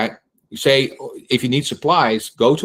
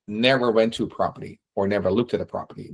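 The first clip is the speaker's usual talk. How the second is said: normal talking voice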